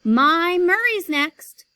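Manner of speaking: loud, high and slow; mother pushing her son forward, loud; pushy mother insisting. Acted. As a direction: stake her claim loudly. Pushy mother claims turn.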